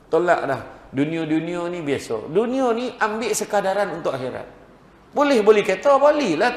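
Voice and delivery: high, loud, urgent sermon push; fiery preaching. Acted. Preacher urging the crowd to weep for God, fiery preaching. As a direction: drive it home to the congregation.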